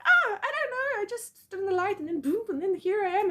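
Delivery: high, loud mock-panicked explaining; flustered panic. Explaining to the man how she got there, flustered. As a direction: act out a squealed, helpless excuse.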